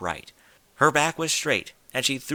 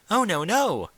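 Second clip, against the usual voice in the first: high, startled denial; surprised protest; 9 semitones higher, 3 dB louder than usual. Acted. Rarity correcting Rainbow, startled and high. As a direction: hurry to correct a misunderstanding.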